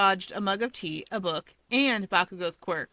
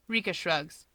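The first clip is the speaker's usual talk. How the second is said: ordinary delivery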